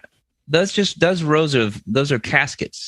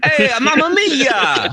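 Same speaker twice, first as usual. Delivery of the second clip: hammed-up Italian accent, slow and higher; 9 semitones higher than usual. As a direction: play a comic Italian exclaiming. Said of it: "How would an Italian man say that".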